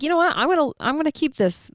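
high, bright decision, voice jumping up; excited. Retold or imitated. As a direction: squeal it out with sudden resolve. Decides to keep the flight.

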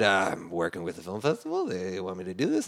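low, soft retold excuse; sheepish explanation. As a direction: explain yourself quietly and sheepishly. Retold sheepish answer to confronting star.

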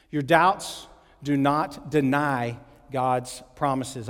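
very slow, word by word; slow emphatic repeat. Shown as a demonstration. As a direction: spell out the point slowly, pausing between phrases. Point repeated slowly for note takers.

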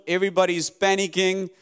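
usual speaking voice

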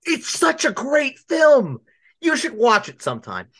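high, slow, drawn-out rave; high gushing praise. Acted. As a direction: swoon over the film. Gushing about Mamma Mia.

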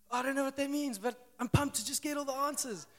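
bursting with naive excitement; eager excitement. Retold or imitated. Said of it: "I'm like".

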